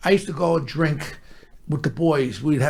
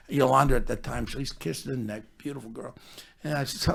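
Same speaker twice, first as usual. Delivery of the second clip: ordinary talk, nothing marked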